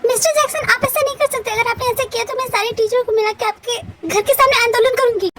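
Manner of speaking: high-pitched, acted outrage; worked-up employee threatening the boss; indignant protest. Employee protests threatened salary cut.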